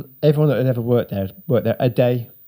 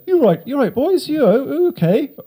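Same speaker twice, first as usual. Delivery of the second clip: much higher, friendly passer-by voice; cheery dog walker. Retold or imitated. Dog walker asking the stranded boys, high cheery voice. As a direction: chirp it like a kindly stranger.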